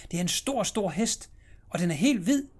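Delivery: put-on high, loud child's delight over stor hest; boy at the window thrilled by the stor, stor hest; excited little boy.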